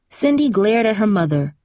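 plain reading voice

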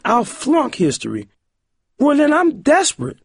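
put-on high, distraught girl's voice; desperate cry. A narrator's reading. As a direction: plead in helpless, high-pitched despair. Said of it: "Corey cried".

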